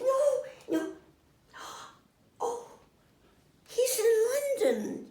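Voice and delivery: high, loud, teary protest with a gasp; distressed. Retold or imitated. Marilyn protesting she can't reach London, high tearful panic. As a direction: imitate her panic breaking through.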